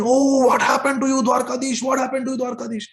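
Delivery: high, shouted cry that fades; screaming in alarm. Acted. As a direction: scream out in shock at the sight. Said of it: "They start screaming".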